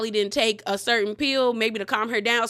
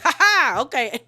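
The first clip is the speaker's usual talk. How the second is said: a louder, pitched-up sarcastic "ha, ha" then a dismissive okay; mocking laugh back; 4 dB louder than usual. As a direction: throw the mock laugh right back at her. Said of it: "[name] starts mocking her back".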